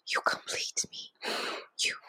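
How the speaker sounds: breathy pretend-romantic whisper; mock romantic whisper. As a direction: whisper it like a cheesy love line. Teasing cohost with movie line.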